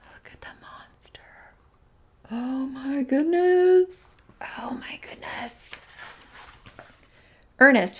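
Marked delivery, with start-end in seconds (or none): 0.1-5.8 whispered mock alarm; awed whisper. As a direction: whisper as if the monster might hear. Hushed aside at the monster.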